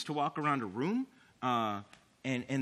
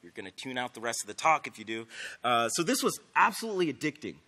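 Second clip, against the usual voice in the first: ordinary talk, nothing marked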